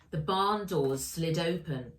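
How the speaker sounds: usual speaking voice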